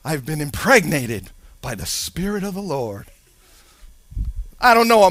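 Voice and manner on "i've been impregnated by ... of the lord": low, soft, sheepish confession; sheepish daughter. Pretend. Daughter confessing to mom, low and sheepish. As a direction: mumble it like a guilty teen. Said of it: "daughter comes home and says".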